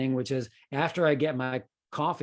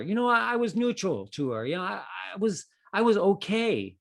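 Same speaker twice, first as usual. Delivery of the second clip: higher, flustered self-defence; defensive protest; 7 semitones higher than usual. Retold or imitated. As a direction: protest your innocence, a bit rattled. Defending himself to daughter.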